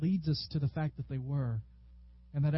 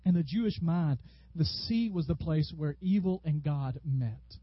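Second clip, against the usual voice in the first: normal talking voice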